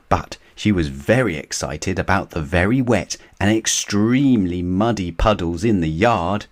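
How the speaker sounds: usual speaking voice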